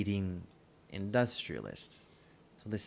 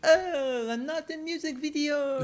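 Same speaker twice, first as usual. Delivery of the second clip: much higher, crying mock taxi driver; mock wail; 5 dB louder than usual. Mocking the taxi driver's dismay.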